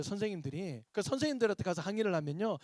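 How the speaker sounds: normal talking voice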